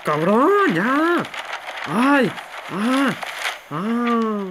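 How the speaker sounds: high, loud panicked yells; real startled yelling. Real, not acted. Gamer jolted by a sudden scare, yelling. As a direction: yelp and curse at the scare.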